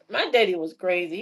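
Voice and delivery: usual speaking voice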